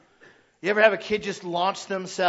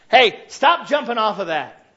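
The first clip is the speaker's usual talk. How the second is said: loud, slowed scolding call; scolding parent; 3 semitones higher, 10 dB louder than usual. Retold or imitated. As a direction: stop the kid with a loud call.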